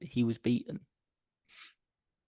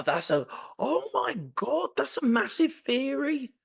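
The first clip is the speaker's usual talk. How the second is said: excited; 13 semitones higher, slower than usual. Real, not acted. Excited at a sudden theory.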